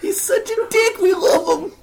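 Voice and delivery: high, laughing through words. Real, not acted. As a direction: crack up while talking. Laughing about friend.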